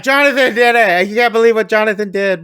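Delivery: high, loud, rushed complaint; mock worked-up rant. Sienna calls her mom about Jonathan, mock frantic. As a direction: imitate a frantic tattling phone call. Acting drunk daughter ranting to mom.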